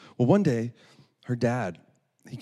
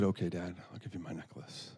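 soft, lower, reluctant surrender; resigned; 3 semitones lower, 9 dB quieter than usual. Retold or imitated. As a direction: give in with a sigh in the voice, acted. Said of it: "She let out a big sigh".

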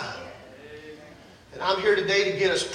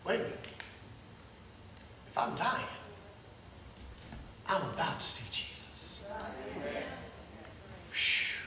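1 hushed awe; 2 semitones lower, 17 dB quieter, slower than usual. Retold or imitated. Recalling peace of near death.

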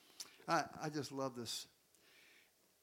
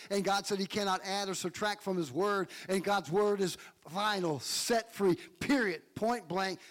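building preacher's proclamation; preaching; 4 semitones higher, 4 dB louder than usual. Acted. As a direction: preach it with rising force. Preaching building to full force.

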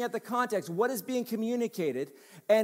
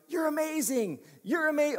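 put-on a light American accent, upbeat praise; mild American-style gush on amazing; 6 semitones higher than usual. Preacher mimicking how an American praises, light American accent. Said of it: "that's how an American would say".

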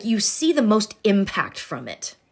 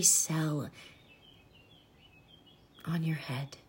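soft spa whisper; 2 semitones lower, 8 dB quieter than usual. Acted. Leads a body breathing meditation.

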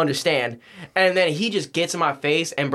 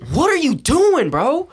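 loud, high, outraged question; incredulous yell; 14 semitones higher, 6 dB louder than usual. Retold or imitated. Yelling at brother who threw the shorts, incredulous. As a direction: shout it in disbelief, pitched way up. Retold reaction to thrown shorts.

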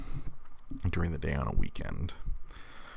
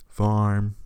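usual speaking voice

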